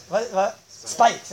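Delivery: usual speaking voice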